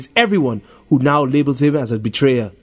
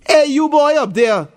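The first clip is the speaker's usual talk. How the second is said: high, calling up to a tree; mild mock shout from a bully; 13 semitones higher than usual. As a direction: call out to someone above. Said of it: "Tony shouts".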